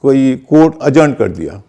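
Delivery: ordinary delivery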